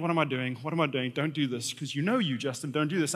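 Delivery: low, quiet talk to himself; anxious self-talk. Retold or imitated. Rider warning himself off a second ride, low and quiet. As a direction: drop it low, as a private inner warning.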